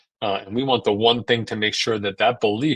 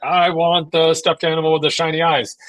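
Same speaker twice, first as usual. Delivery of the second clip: put-on whiny toddler; 5 semitones higher, 4 dB louder than usual. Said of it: "talking with a screaming toddler".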